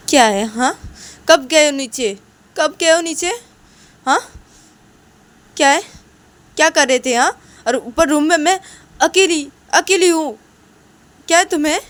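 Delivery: high, loud irritated demands; angry yelling. Acted. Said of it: "इतना चिल्लाओ ना मत". (गुस्से में चिल्लाते हुए)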